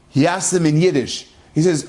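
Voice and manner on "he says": ordinary delivery, nothing marked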